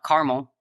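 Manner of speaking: "caramel" squeezed into CAR-mul; two-syllable caramel. Shown as a demonstration. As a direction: drop the middle vowel in "caramel", CAR-mul. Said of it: "how do you pronounce caramel".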